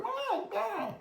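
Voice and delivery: put-on squeaky baby-creature babble, very high; Grogu babbling. Vlogger mocks Grogu's babbling while piloting, squeaky. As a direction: mimic Grogu's whiny babble. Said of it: "I don't wanna hear your".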